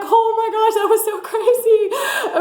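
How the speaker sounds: high, laughing, slowing on "so crazy". Retold or imitated. Back in her car after scaring the woman, laughing. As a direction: laugh off the embarrassment. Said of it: "I laughed about it".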